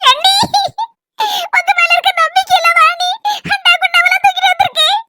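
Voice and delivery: high-pitched tearful complaint; tearful wail. Acted. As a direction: wail it out to your sister-in-law, high. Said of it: "அழாத மக்கா". (அழுதுகொண்டே உயர்ந்த குரலில் புலம்பல்)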